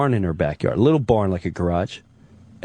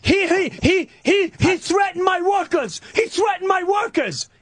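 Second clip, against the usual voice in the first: very high stammering mock whine; mocking the neighbor's whining to police; whiny neighbor. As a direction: sputter like a tattling man-child.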